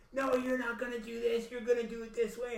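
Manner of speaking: high mocking girlfriend voice; imitating bossy Haley. Haley ordering him around, high mock bossy. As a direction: put on her high, insistent voice.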